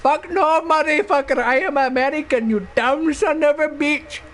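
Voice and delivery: indignant retort in put-on Indian accent; mock Indian accent. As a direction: fire back high-pitched in a fake Indian accent. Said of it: "you're not Indian".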